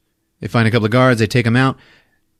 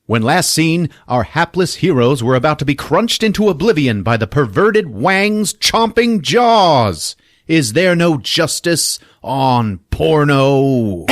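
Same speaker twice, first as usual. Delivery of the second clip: hammed-up announcer voice, higher and louder; mock serial recap; 6 semitones higher, 4 dB louder than usual. Podcast host doing the serial announcer's recap. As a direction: read it like an old cliffhanger serial narrator.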